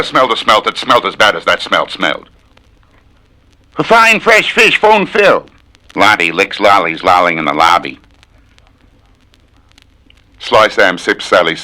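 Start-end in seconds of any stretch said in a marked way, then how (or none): 3.8-5.5 much higher, piping voice; a tongue twister piped up high; high squeaky voice. Shown as a demonstration. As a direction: say it in a squeaky falsetto. High put-on voice.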